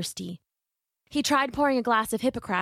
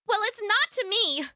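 high-pitched angry retort from a daughter; yelling back; 12 semitones higher than usual. A narrator's reading. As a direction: yell back at a stubborn father. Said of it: "she yelled back".

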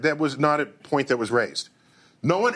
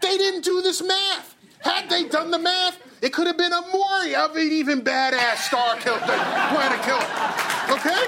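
excited laughing rant; 10 semitones higher than usual. Real, not acted. Excited rant about movie science.

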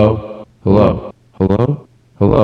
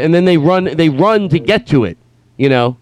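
ordinary talk, nothing marked

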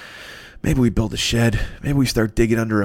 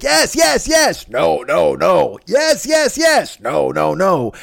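mock arguing crowd; 6 semitones higher, 4 dB louder, much slower than usual. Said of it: "Everybody's like".

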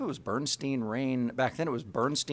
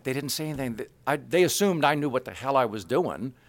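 normal talking voice

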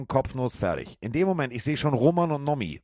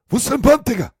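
retold shout; 14 semitones higher, 14 dB louder than usual. Security man grabs him, shouting.

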